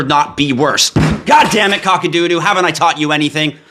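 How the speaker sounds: over-the-top fake outburst, shouting God damn it again and again; mock rant on request. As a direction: blow up in a theatrical tantrum to please the viewer. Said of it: "just pound your desk, make me happy".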